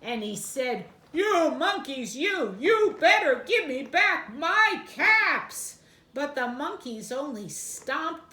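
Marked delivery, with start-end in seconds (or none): 1.1-5.8 loud, stern warning; quite angry peddler. Acted. Peddler warning the monkeys, loud and stern. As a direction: stamp and threaten them. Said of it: "Now the peddler felt quite angry".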